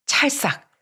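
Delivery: a sharp, high mock slap noise; imitated slap. Narrator mimics slapping his wife, sharp mock slap noise. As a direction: smack it out like a slap on the cheek.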